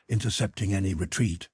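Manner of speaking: ordinary delivery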